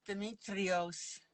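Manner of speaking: put-on slow, drawn-out Greek accent. Trying the Greek accent on a viewer's name. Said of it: "I got that accent, don't I".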